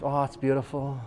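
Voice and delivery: soft, low murmur of wonder; hushed tender awe between new parents; warm, moved. Acted. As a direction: say it softly, touched by the moment. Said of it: "there's that warm moment".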